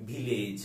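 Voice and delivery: "ভিলেজ" as "bhi-lej", bh in place of v; Bengali-style village. Shown as a demonstration. Teacher repeating the local habit for "ভিলেজ": bhi-lej. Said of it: "কি বলি?".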